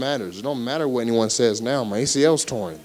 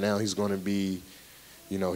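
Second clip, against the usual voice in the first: ordinary talk, nothing marked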